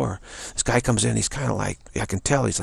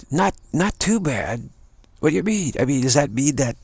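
high, stretched echo of his answer; incredulous questioning; 6 semitones higher, 3 dB louder than usual. Retold or imitated. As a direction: challenge him in a raised pitch. Acts own challenge to customer.

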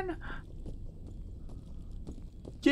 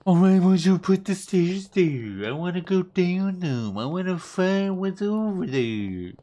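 put-on higher, whiny little-kid voice; higher childish whine about the stairs; childlike voice; 5 semitones higher than usual. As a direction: whine like a curious small child. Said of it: "I don't know why I'm talking like this".

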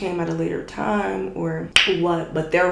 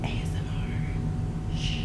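slow, soft whisper; ASMR whisper; 8 dB quieter, slower than usual. Pretend. Vlogger jokes about making an ASMR video, whispering. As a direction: whisper the letters slowly into the mic. Should I title it A S M R.

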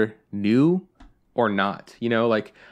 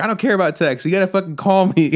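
loud, raised-pitch retold demand; mock angry dad; 8 semitones higher, 6 dB louder, faster than usual. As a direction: imitate a fed-up dad insisting on the phone. Retelling dad's angry demand.

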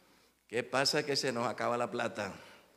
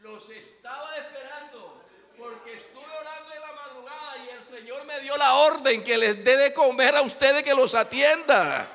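high voiced host; 11 semitones higher, 7 dB louder than usual. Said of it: "Dice, y hace las manos así".

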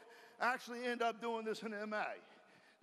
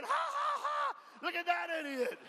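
mocking laughter; 6 semitones higher than usual. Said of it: "and they're laughing".

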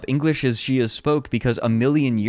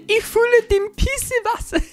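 tiny German voice. Acted. Reading silly German phrases to viewers, tiny German voice. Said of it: "in the tiny German voice".

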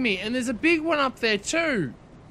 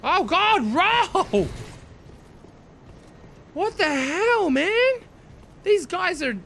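panicked shouting; 8 semitones higher, slower than usual. Real, not acted. Gamer yelling at his character to roll, panicked shout. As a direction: shout in rising alarm at the screen.

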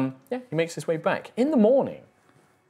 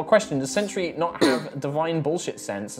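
ordinary talk, nothing marked

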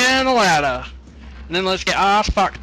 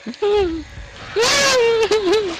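high wailing half laugh; a frightened squealing laugh; nervous cry-laugh. Real, not acted. Nervous laugh-cry in horror game.

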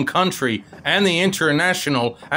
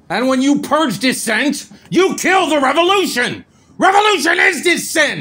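rising to a furious, high shout; building from heated to full furious yell; angry shout; 10 semitones higher, 4 dB louder than usual. Acted. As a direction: lose his temper and yell it out. Heated argument escalating to shouting.

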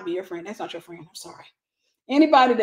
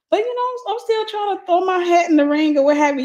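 pitched up, coy and teasing; playful coy high voice; 9 semitones higher, 5 dB louder than usual. Retold or imitated. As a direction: tease it up high like flirting. Jokingly pitching herself to a man.

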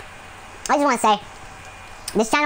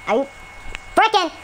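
rage; 12 semitones higher than usual. Real, not acted. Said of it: "Sorry for the rage there".

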